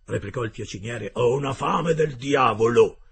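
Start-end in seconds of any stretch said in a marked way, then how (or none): 1.1-3.0 slow, loud rough sailor voice; a hearty sailor, loud and slow; gruff harpooner Ned. Acted. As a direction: play the blunt Canadian harpooner, drawn out. Ned Land's voice in dialogue.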